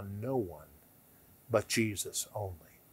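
whispered, reverent; soft reverent whisper; hushed whisper. Acted. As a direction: breathe the words in awe.

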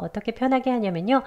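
normal talking voice

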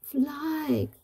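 high-pitched urgent call; storybook cry. A narrator's reading. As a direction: cry out a warning, high and drawn out. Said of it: "cried Dragonfly".

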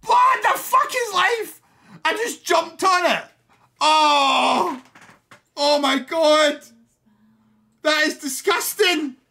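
high, loud and slow disbelief; real gamer rage, loud and high; outraged shouting. Real, not acted. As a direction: yell at the screen in outrage. Outraged at being killed.

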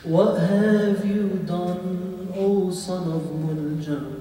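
slow, half-sung grieving lament; mournful lament. Acted. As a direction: draw out each phrase like a dirge. Mourning lament to Ibn Muljam.

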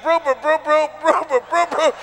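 mock stutter on "brew, brew"; imitating stutter. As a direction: stammer on "brew" trying to reach Bruce. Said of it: "he could never say Bruce".

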